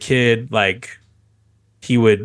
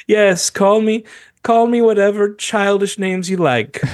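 higher, lightly voiced, smug and patient; smug cat; 8 semitones higher than usual. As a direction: play the cat brushing off the insults. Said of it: "Heathcliff is looking at him like".

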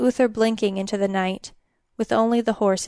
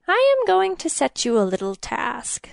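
higher, creaky and wheedling; scraping king; 5 semitones higher than usual. A narrator's reading. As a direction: set the trap in a scraping voice. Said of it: "his voice like the back of a bow being dragged over an untuned".

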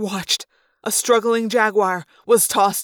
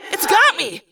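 scream; 9 semitones higher than usual. A narrator's reading. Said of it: "Cassie's ThoughtSpeak voice just screamed".

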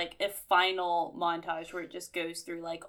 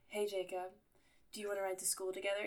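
soft quick ask; 9 dB quieter, faster than usual. Retold or imitated. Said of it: "she's like".

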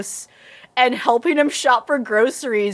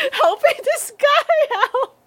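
words squeezed through high, slow laughter; laughing; slower than usual. Real, not acted. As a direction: laugh helplessly while you get it out. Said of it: "I'm starting to laugh".

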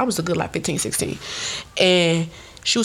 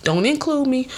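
ordinary delivery

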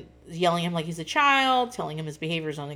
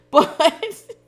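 high laugh into the next word; 10 semitones higher, 9 dB louder than usual. Real, not acted. Vlogger laughing at herself, high and loud.

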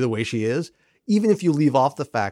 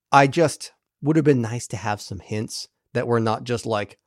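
ordinary talk, nothing marked

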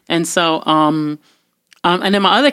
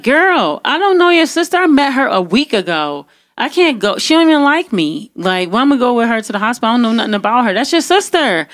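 higher-pitched, exasperated refusal; indignant protest; 5 semitones higher, faster than usual. Retold or imitated. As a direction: argue your way out, re-enacting the quarrel. Retells her protest to the sister.

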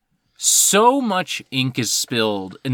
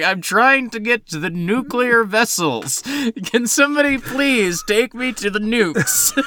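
put-on Russian accent; 7 semitones higher than usual.

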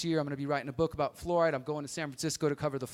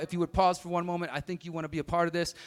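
ordinary talk

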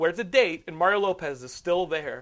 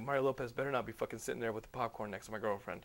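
fuming mutter; 6 semitones lower, 10 dB quieter, faster than usual. Retold or imitated. Fuming thought walking back.

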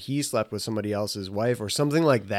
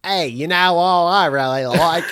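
louder, higher mock Boston accent; 4 semitones higher, 5 dB louder than usual. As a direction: lay on a bad Boston accent. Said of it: "that's not a good Boston accent".

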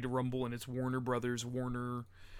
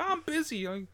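high, put-on whiny excuse voice; mock busy excuse; 7 semitones higher, 8 dB louder than usual. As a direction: brush it off in a fake-polite voice. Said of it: "They'll be like".

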